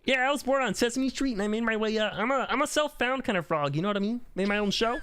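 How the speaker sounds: high puppet-frog voice; Kermit. Shown as a demonstration. Frog telling friends his rise to fame, high puppet voice. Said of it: "I can do a Kermit".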